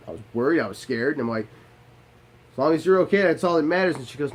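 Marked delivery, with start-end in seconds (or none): 2.5-4.0 loud reassurance. Retold or imitated. Reassuring wife after scare.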